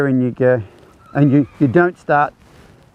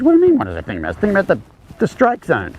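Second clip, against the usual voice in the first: incredulous; 6 semitones higher, faster than usual. Retold or imitated. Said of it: "She goes".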